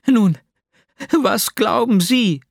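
hoch und fordernd gefragt; dräng aufgewühlt auf eine Antwort; aufgebrachtes Drängen. Acted. (aufgewühlte, fordernde Frage)

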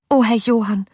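lightly voiced anxious young woman; blurted exclamation. A narrator's reading. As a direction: let it slip out in distress. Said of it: "entfuhr es ihr".

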